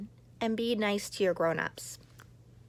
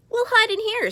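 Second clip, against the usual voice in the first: higher, louder urgent call; 13 semitones higher, 10 dB louder than usual. Acted. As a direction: Goblin rushing into the cave. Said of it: "said Goblin".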